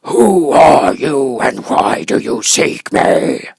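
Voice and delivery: slow, loud monstrous roar; great roar. Acted. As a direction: boom it out like a terrible beast. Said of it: "in a voice that was one great roar".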